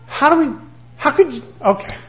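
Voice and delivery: high, halting, lightly mocked disbelief; exasperated mentor. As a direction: imitate a teacher stumped by the question. Acts out his exasperated mentor.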